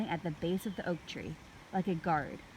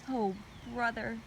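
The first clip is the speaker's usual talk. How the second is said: plain reading voice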